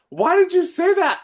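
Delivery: retold disbelief. Family member's baffled question retold.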